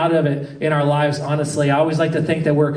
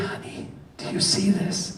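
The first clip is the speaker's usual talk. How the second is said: whispered, barely audible; very quiet whisper; 9 dB quieter than usual. Retold or imitated. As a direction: whisper it so only your wife hears. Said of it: "very quietly".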